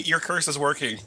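normal talking voice

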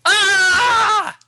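sudden high, loud scream; horrified scream. Acted. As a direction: shriek in mock horror. Reading horror diary, screams.

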